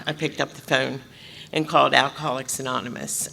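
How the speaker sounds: normal talking voice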